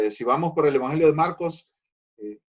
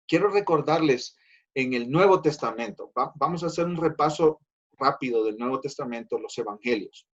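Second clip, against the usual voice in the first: normal talking voice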